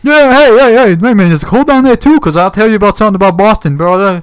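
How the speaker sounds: loud, high mock Boston bro; fake Boston streamer. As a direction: brag like a dim Boston guy on a livestream. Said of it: "he's talking like an idiot".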